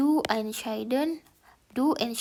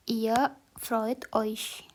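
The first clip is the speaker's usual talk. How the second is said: slow German example; much slower than usual. Shown as a demonstration. Reading German example slowly.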